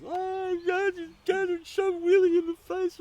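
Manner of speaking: very high, loud mock bawling; bursting into wails. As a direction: wail it like a hysterical child confessing. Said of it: "The floodgates burst".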